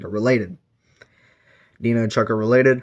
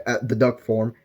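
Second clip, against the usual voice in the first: usual speaking voice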